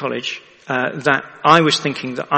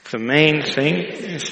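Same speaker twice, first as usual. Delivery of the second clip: slow call for repetition; slower than usual. Shown as a demonstration. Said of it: "Repeat after me".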